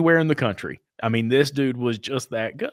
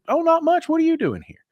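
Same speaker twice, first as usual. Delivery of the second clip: imitating the mechanic, much higher and light; a mock-pleasant, much higher mechanic voice; friendly mechanic. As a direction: play the cranky mechanic turning sweet all of a sudden. Grumpy mechanic suddenly acts friendly.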